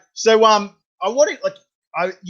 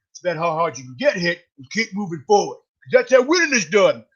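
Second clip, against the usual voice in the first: put-on Rocky accent. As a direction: end the Rocky speech in his Philadelphia accent. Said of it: "You sounded pretty Rocky there".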